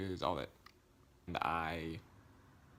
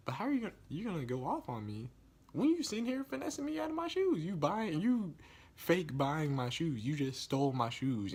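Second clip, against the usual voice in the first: high-pitched, louder rant of disbelief; indignant; 9 semitones higher, 5 dB louder than usual. Real, not acted. Seller ranting at the man who stole his shoes, indignant. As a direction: let outrage push the voice up and loud.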